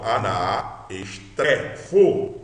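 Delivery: slow, with "estrefo" drawn out; divided word. Shown as a demonstration. Teacher shows the word's division, drawn out in parts. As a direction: separate "aná" from a long "estrefo". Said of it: "isso continua".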